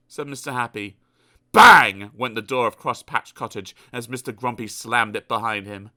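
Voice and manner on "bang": loud, punchy sound word; loud bang. A narrator's reading. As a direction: slam it out. Door slammed.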